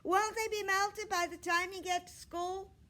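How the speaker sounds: put-on high, loud kid voice; a loud boy with no indoor voice. A narrator's reading. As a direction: boom it across the classroom. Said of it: "he said in his loud voice".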